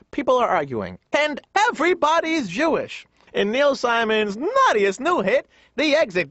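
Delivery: high, hammed-up theatrical billing; mock announcer. Comic announcing Neil Simon's new hit, mock announcer. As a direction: sell the play title with big swoops.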